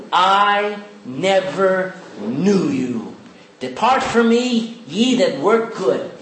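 slow deliberate misquote; wrong version. Shown as a demonstration. Preacher slowly misquoting the verse to test the church. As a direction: drag it out slowly, as if reading it wrong on purpose. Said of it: "Is that what he said?".

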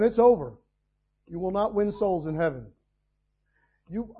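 ordinary delivery

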